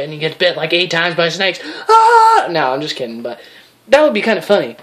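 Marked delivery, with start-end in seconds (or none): 1.9-2.5 sudden much higher, louder shriek; mock scream. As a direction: shriek as the snakes strike. Snakes bite the friend.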